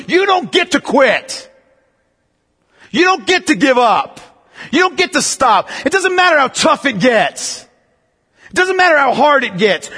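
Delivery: high, forceful, emphatic exhortation; militant preaching. Real, not acted. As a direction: hammer each refusal home. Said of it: "That's a little more militant".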